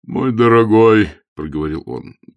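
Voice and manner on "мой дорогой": loud, drawn-out, sorrowful address; hammed-up pained bishop; pained, grieving appeal. A narrator's reading. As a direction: plead with him in mild anguish. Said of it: "Епископ скривился от горя".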